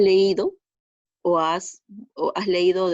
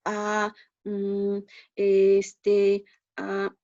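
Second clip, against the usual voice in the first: long, level, drawn-out hesitation sounds; filler words demonstrated; much slower than usual. Shown as a demonstration. Teacher showing students what muletillas sound like, drawn-out hesitant fillers. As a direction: stall on each filler as if searching for words. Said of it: "esas interferencias de".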